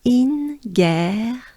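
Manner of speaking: "In guerre" with a high "In" and a French R in "guerre"; breathe, then model "In guerre" in two drawn-out syllables; syllable demo. Shown as a demonstration. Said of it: "Let's do it together with a good breath".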